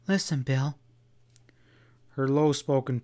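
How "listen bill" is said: lightly voiced urgent plea; quavering urgency. A narrator's reading. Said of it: "with urgency".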